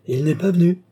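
a man's sudden joyful outburst; joyful exclamation. A narrator's reading. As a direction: burst out with glee at the good news. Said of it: "s'écria-t-il joyeux".

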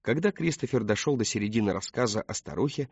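usual speaking voice

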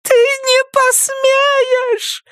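very high, very slow wail; howling cry. Acted. Nettie howling at the phone, high wail. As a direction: howl it out in despair. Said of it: "взвыла Нетти".

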